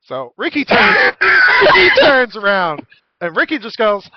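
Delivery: normal talking voice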